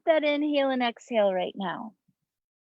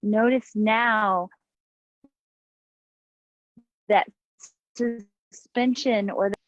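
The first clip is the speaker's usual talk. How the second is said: guided meditation. Acted. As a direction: guide them into the breath, unhurried. Leads breathing meditation over music.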